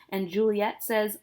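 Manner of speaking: normal talking voice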